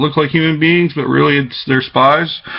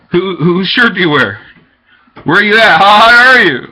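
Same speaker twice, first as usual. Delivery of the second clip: higher, louder taunting questions; taunting calls; 4 semitones higher, 4 dB louder than usual. Real, not acted. Taunting the people around him, louder calls. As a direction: call it out mockingly.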